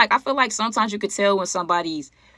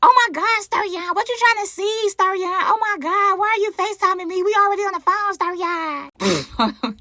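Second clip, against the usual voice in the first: much higher, whiny put-on voice; her forced voice; 11 semitones higher than usual. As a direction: whine it high and flustered. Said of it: "She's like".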